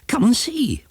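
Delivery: high, urgent call to come look; eager cry. Acted. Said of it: "cried Broomstick".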